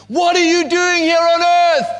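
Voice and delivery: a high, loud screaming au pair in a muddle. Retold or imitated. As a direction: scream it at the wrecked room. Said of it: "so she screams".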